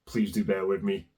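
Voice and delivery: low, soft, hoarse sick voice; hoarse from a cold. Real, not acted. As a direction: rasp like a heavy smoker with flu. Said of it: "I sound like I've smoked sixty cigarettes".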